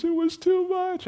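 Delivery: high whiny mock complaint of a tearful teenager; homeschooled girl whining her homework was too much; whiny teen. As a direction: squeak it out like an overwhelmed student. Students returning in tears.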